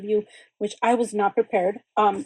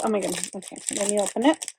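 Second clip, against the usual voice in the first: usual speaking voice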